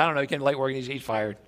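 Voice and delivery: low, offhand husband; man of few words. Acted. Husband brushing off his wife's questions, low and terse. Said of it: "you know how men are when they give you the details".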